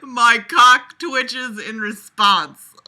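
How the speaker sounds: loud laugh then slow laughing read; can't keep it together; bursting out laughing then reading slowly. Real, not acted. Laughing reading a crude line.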